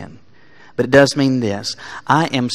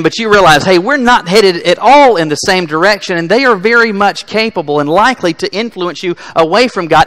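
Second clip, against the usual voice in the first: louder, higher, driving sermon voice; full-force preaching; 5 semitones higher, 9 dB louder than usual. Acted.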